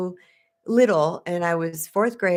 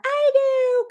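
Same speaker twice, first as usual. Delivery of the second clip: very high, loud mock kid cheer; imitated cheer of eager children; 4 dB louder than usual. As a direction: squeal it like excited kids in a car. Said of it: "this cheer goes up".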